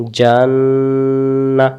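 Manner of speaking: জান্না stretched very long on the vowels; জান্না said very slowly with a long falling end; drawn-out jannah. Shown as a demonstration. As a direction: hold জান্না slowly, teacher modelling. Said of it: "আরেকবার".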